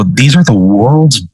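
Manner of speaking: slow, stretched-out stoned amazement; savoring it slowly, lightly acted; drawn-out awe. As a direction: stretch the words out, mock awestruck. Acts out player's awe at the joint.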